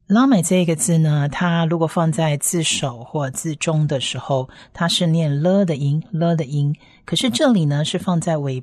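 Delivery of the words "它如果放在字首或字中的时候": ordinary talk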